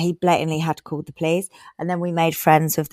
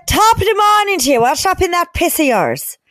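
put-on high comedy Irish accent; 10 semitones higher than usual. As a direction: ham up a stage Irish accent. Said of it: "A lovely Irish man".

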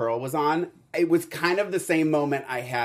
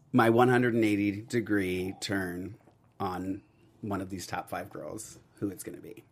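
low, much softer, with long pauses; suspenseful hush; 8 semitones lower, 7 dB quieter than usual. Pretend. Host teasing a change of heart, suspenseful hush. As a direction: draw out the big reveal in a mock-solemn hush. Staged suspense before a reveal.

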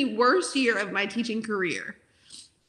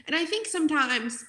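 ordinary talk

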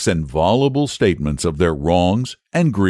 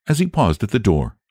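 plain reading voice

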